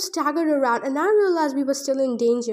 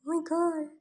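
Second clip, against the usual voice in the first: softer, strained, real dismay; shaken; 2 semitones higher, 6 dB quieter than usual. Real, not acted. Reader reacting to the death, quiet dismay. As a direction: let the shock sink in. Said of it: "I can't read anymore".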